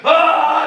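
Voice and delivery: put-on high, loud baby rage roar; mock Hulk rage. Father imitates his one-year-old raging for a bottle, mock Hulk rage. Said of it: "When he Hulk rages".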